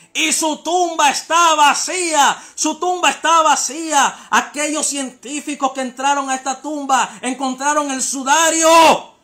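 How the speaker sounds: loud, high-pitched full-force sermon; fiery preaching. Real, not acted. Preacher urging listeners that Christ lives, fiery shouted preaching. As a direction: shout it to the crowd, drawing out the final words.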